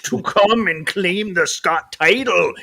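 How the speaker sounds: loud, slow, booming announcer hype; wrestling promo. Acted. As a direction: call it out like a ring announcer. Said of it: "we should do a wrestling promo and be like".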